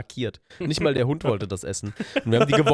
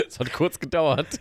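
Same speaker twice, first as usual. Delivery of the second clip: real laugh into slow words; 7 semitones higher, slower than usual. Real, not acted. Cohost laughing at the host's backwards shout. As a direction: laugh then talk slowly.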